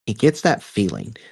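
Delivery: plain reading voice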